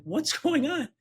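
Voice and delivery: high-pitched imitation of a bewildered wife; mock-baffled, pitched well up; retold puzzled question. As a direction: ask it high, mimicking puzzlement.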